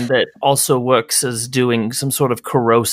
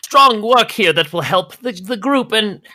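higher, slow and put-upon; haughty Agnes; 6 semitones higher than usual. Acted. Agnes lectures Welsh about her important work, exasperated. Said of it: "she says".

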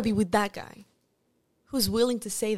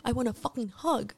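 ordinary talk, nothing marked

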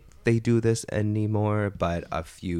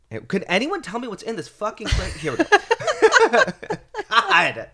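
rising into a high laugh, much higher by the end; laughing exasperation; 9 dB louder than usual. Real, not acted. Asking about the perfume notes, laughing. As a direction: fight the giggles while asking. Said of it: "private detective over here".

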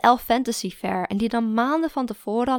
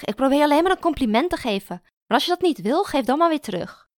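louder, higher annoyed push; exasperated retort; 5 semitones higher, 5 dB louder than usual. A narrator's reading. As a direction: snap back, offended. Mara irritated. (geërgerd)

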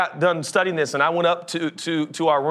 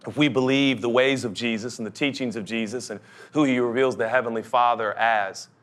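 usual speaking voice

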